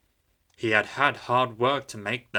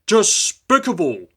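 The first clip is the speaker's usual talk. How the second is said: slow, loud, higher contempt; indignant; 10 semitones higher, 8 dB louder than usual. A narrator's reading. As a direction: finish with lofty scorn. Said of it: "finished Henry".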